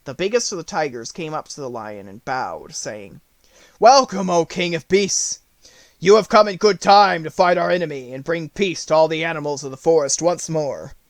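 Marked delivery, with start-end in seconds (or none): 3.8-11.0 louder, proud tiger voice; tiger greeting. A narrator's reading. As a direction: hail the king with respect.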